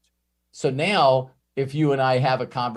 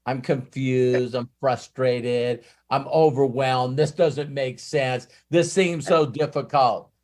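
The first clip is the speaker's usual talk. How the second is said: whiny slow complaint; slower than usual. Retold or imitated. Client complaints voiced slowly.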